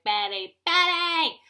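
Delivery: building to a high yell; mad husband yelling. Acted. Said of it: "he gets mad at me".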